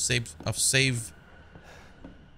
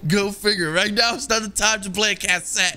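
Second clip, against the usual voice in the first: sarcastic; 8 semitones higher, 4 dB louder than usual. Real, not acted. Sarcastic reply to game.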